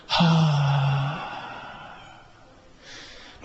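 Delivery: long, even, soft "haa" that fades out; soft relaxed exhale tone. Shown as a demonstration. Teacher repeating the relaxed breath for the class. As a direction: float the breath out on a gentle tone. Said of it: "もう一回".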